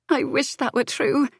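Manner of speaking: high, hurt voice near tears; tearful. A narrator's reading. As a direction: hold back the tears. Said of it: "tears glistening in those stormy eyes".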